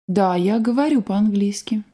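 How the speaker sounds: normal talking voice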